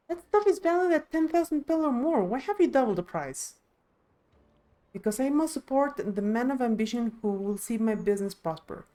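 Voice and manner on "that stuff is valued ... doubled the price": high, loud, outraged objection; indignant protest. Acted. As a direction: protest the doubled price in disbelief. Indignant protest at doubled price.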